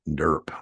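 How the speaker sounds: derp whispered under the breath. Pretend.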